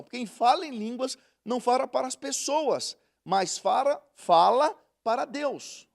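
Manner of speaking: slow, solemn Bible reading; deliberate preacher's reading voice; scripture reading. Acted. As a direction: read each word out to the church. Reads scripture.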